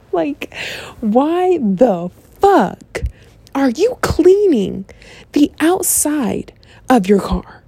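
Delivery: very slow, drawn-out mock outrage; exasperated disbelief. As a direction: stretch every word in fed-up disbelief. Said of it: "I threw a fit".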